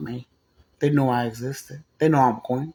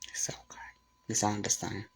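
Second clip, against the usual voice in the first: whispered, retold reassurance; hushed understanding; 7 semitones lower, 16 dB quieter than usual. Man hearing of her childcare problems, retold hushed whisper. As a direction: drop to a whisper to accept the excuse.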